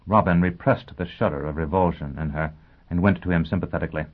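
ordinary delivery, nothing marked

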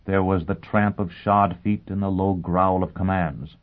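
ordinary delivery, nothing marked

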